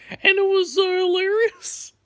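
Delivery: real burst of excitement; excited squeal. Real, not acted. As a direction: squeal it out with glee. Laughing recalling the crash.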